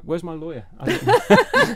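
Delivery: breaking into laughter; laughing through the words. Real, not acted. Laughing at the question.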